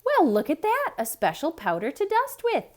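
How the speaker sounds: exclaiming. A narrator's reading. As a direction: burst out in delight. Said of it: "exclaimed Amelia Bedelia".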